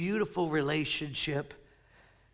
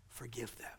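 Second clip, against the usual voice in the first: soft unvoiced whisper, much softer; whispered prayer; 5 semitones lower, 16 dB quieter than usual. Jesus praying for executioners, whispered. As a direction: breathe the words like a prayer.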